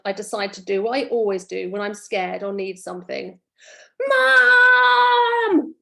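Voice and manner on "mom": long, high, loud scream for Mom; child shrieking for Mom. Acted. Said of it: "I screamed".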